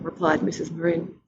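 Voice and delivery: ordinary delivery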